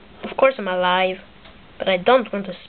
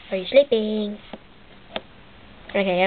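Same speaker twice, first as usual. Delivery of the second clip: ordinary talk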